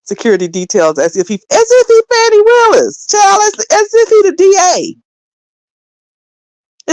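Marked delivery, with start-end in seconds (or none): 1.3-6.9 pitched way up, loud and scoffing; incredulous mock outburst. Real, not acted. Host mocking a rival's security detail, scoffing high and loud. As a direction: scoff at the idea, voice jumping high.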